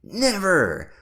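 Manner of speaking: put-on boy yelling back at the pirate; yelled. A narrator's reading. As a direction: shout it back in defiance. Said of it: "Ben yelled back".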